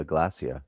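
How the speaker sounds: British-style "glacier"; David Attenborough. Retold or imitated. Streamer asking a British friend about it, "glacier" as GLASS-ee-uh. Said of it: "don't say it like David Attenborough".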